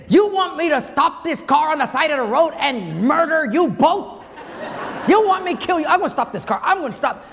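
hammed-up mother threatening to stop the car; mock furious mom. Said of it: "And then she'd say this".

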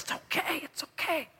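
soft, high, hushed wife's voice; calm reassurance. Acted. Wife soothing her dying husband, calm reassurance. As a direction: soothe gently, almost whispered. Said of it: "you'd stay so calm".

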